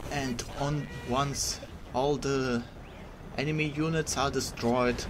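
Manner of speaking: mock Russian officer accent. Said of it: "wannabe Russian voice acting impression by me".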